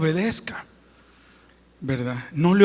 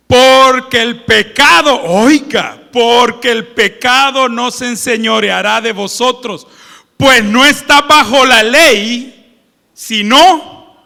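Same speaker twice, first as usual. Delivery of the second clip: fervent preacher reading; preaching scripture; 9 dB louder than usual. Acted. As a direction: proclaim the verse to the congregation. Reading scripture at full force.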